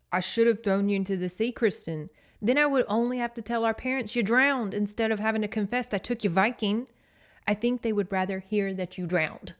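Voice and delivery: loud, scolding big-brother growl. Retold or imitated. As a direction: growl it forcefully at a little sister. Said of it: "sat her up to growl at her".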